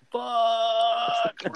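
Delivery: high, sharp yelled curse; screamed curse. Retold or imitated. Man shouts as he gets out of the car, a retold scream. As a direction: shout it out in sudden panic. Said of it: "I screamed".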